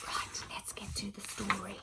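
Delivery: hushed whisper to start the story; playful whisper. Acted. Teacher whispering to her class to begin the story, hushed. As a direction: lean in and whisper it.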